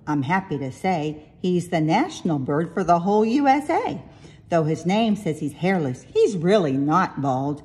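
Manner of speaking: ordinary delivery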